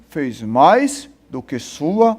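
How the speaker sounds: low, slow, dismissive reply; dismissive parent. Retold or imitated. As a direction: brush off the child's good news. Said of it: "aí você diz". (pai desdenhoso, voz grave e lenta)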